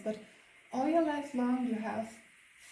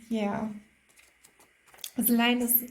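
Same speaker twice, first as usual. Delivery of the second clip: ordinary talk, nothing marked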